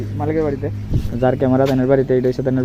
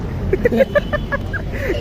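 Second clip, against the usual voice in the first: burst of laughter. Real, not acted. Laughs at forgotten battery.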